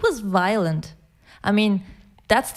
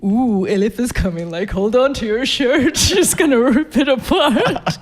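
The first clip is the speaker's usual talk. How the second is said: a touch of sing-song teasing, laughing; hammed-up family ribbing; mocking teasing; 2 semitones higher, 5 dB louder than usual. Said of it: "all of these people made fun of me".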